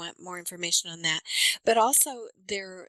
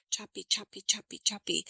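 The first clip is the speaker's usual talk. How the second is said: choppy, broken-up repeated beats; choppy rhythm. Shown as a demonstration. Coach showing missing final consonants, choppy rhythm. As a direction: chop each word off from the flow. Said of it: "it gives a very choppy".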